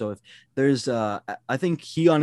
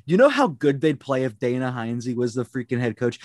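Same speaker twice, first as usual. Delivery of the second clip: ordinary delivery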